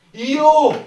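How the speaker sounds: a huge high savage yell; barbaric yawp. Shown as a demonstration. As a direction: roar it like a barbarian. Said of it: "mieux que ça".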